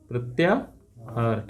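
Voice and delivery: प्रत्याहार slowly, split as प्रत्या, pause, हार; slow dictation. Shown as a demonstration. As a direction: break प्रत्याहार in two for learners, low and soft. Teacher repeats term, broken up. (प्रत्याहार को धीरे-धीरे तोड़कर बोलें)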